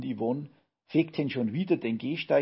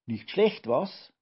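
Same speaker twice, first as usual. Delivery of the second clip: higher, louder, lightly boastful call; proud call; 4 semitones higher, 5 dB louder, slower than usual. A narrator's reading. Grandpa shows off after lifting the washing machine, proudly calling. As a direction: show off with a touch of pride. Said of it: "rief er stolz".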